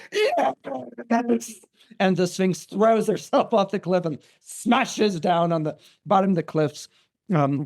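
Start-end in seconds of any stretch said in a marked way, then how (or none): 0.1-1.5 high, drawn-out strained yes; Sphinx's anguished cry. Retold or imitated. Sphinx hearing the right answer, high anguished cry. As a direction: cry it out high, as a beaten monster.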